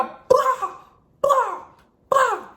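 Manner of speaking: loud, explosive high "bah" bursts; imitated banging. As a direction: mimic someone ramming a door. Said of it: "Someone was banging".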